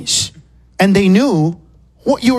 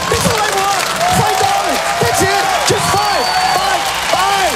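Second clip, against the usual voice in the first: exasperated shout; 7 semitones higher than usual. Retold or imitated. Comedian yelling at the cashier about his change. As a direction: spell it out to her like she's slow.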